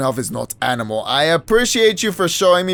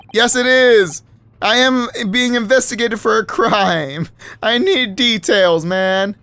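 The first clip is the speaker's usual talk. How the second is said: higher, louder protest; indignant hero; 8 semitones higher, 5 dB louder, slower than usual. Acted. Accused hero demands details from the witness, loud indignant. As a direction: insist hard on your right to know.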